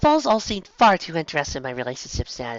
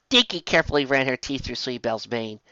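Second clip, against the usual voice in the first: ordinary delivery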